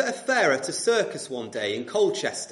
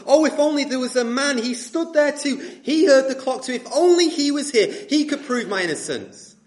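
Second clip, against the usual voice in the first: desperate plea; 6 semitones higher, 4 dB louder than usual. Acted. Accused pleads in court.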